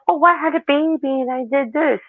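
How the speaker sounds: high, sing-song boastful new-mom voice; mocking proud mom. Mocking a bragging mom about her baby, high and sing-song. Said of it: "and say".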